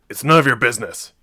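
loud, irritated snap; snapped. A narrator's reading. Sergeant to nosy pilot about broken chair, snapping. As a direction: shut the question down sharply. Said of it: "he snapped".

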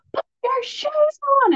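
shrill high mock yell at the kids. Mother yelling at kids to put shoes on, mock yell. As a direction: lose it over the shoes. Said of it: "I said it three times and they didn't do it and I lost it".

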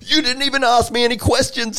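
very high, loud furious yell; accent dropped, outraged. Acted. Instructor tearing into a recruit's plan, outraged yell. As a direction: explode at the recruit, acting it out in full. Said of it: "the accent just disappears".

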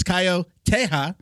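Caio Terra with a light Brazilian accent, Terra as "TEH-ha"; Brazilian pronunciation. Shown as a demonstration. Host redoing the introduction of Caio Terra, Brazilian pronunciation. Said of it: "should I say it like I'm a Brazilian".

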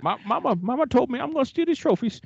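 high southern put-on voice; Water Boy southern voice. Host tries Water Boy southern voice, high whine. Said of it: "less Water Boy".

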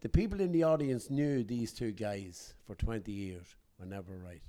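ordinary talk

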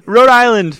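a loud, high, hammed-up Peter Griffin take on Rhode Island; Peter Griffin impression. Co-host jokes about Rhode Island as Family Guy's Peter Griffin, loud and high. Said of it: "the Peter Griffin Family Guy factor".

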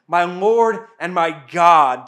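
loud, slow exclamation, falling at the end; exclaimed. A narrator's reading. Thomas repeats his confession to Jesus, loud and slow. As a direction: cry it out slowly and loudly as awed confession. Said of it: "Thomas exclaimed".

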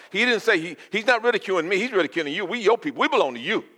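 high, loud preaching build; fervent preaching. Acted. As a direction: lift it up, pleading for the people.

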